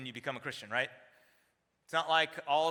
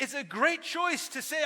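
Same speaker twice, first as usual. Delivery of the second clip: normal talking voice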